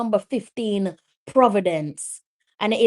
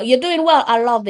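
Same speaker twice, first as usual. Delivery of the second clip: cheery praise; 4 semitones higher, 6 dB louder than usual. Real, not acted. Cheering on a viewer.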